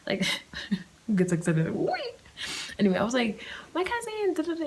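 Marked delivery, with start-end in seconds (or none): 1.7-2.2 high squealed what; mock squeal. Vlogger mocking her own excitement with a high squeak. 3.7-4.6 high, squealed delight; squealing excitement. Retold or imitated. Vlogger retelling seeing her cousin, squealing high.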